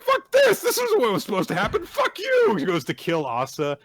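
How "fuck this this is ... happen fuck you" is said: high-pitched furious mock yelling; shrieked outburst of imitated fury; mad. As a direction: scream it in hammed-up rage. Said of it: "really mad at Famine 'cause he's like".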